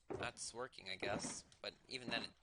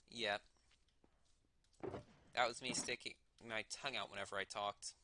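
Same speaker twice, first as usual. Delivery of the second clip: usual speaking voice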